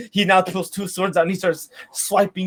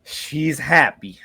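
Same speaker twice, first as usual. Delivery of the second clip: very drawn-out, lower delivery; slow acknowledgement; 4 semitones lower, 3 dB quieter, much slower than usual. Acted. Quest-giver nodding to the party for acknowledgement, slow and deliberate. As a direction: let each word sink in slowly. Calmed character seeking acknowledgement with nod.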